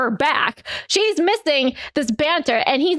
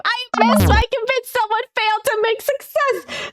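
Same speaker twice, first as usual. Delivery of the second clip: dice dismay; 9 semitones higher than usual. Real, not acted. Player reacting to a failed roll, high dismayed outcry. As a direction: yelp at the bad dice.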